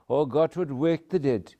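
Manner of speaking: usual speaking voice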